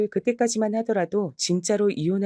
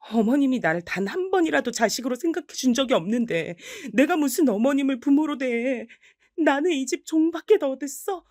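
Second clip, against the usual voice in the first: higher, indignant, voice rising; bitter angry outburst; 7 semitones higher than usual. Acted. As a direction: pour out years of resentment. Wife lashes out at husband.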